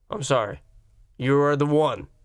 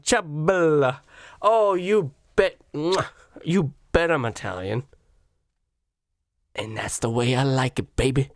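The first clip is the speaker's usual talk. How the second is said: mock Italian. Playing Italian at restaurant.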